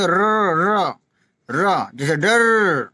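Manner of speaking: र rolled with the tongue tip, slow and drawn out; flapped r, drawn out; trilled r demo. Shown as a demonstration. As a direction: trill र slowly as in डर. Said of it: "र, र बोलेंगे".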